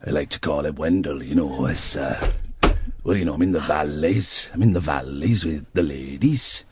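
deep Welsh crooner's speaking voice. Acted. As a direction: drop low and ramble in a Welsh lilt. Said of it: "impression of a very famous Welsh singer".

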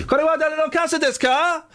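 very high, sing-out Japanese question; acted tourist Japanese. Host re-enacts asking strangers in Japan, high-pitched questioning. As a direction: call it out high, as if asking passers-by. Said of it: "I was saying that phrase as often as I could".

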